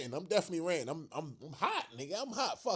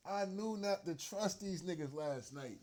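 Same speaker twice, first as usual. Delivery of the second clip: usual speaking voice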